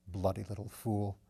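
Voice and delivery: gruff tenderness over a sleeper; affectionate. Acted. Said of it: "An affectionate note crept into his voice".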